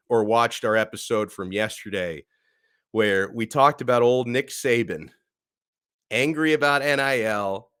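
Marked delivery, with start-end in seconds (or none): none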